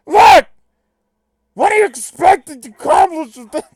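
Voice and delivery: loud, high, strained cartoon impression; botched impression, very loud and high; crappy Bender. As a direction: shout it in a badly faked robot voice. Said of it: "Can I just do crappy Bender?".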